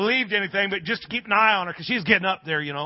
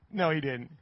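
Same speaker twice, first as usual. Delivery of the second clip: low, soft dry contradiction; imitated neighbor's calm rebuttal; amused denial; 4 semitones lower, 7 dB quieter than usual. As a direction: quietly deny it, unimpressed. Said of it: "he's laughing and said".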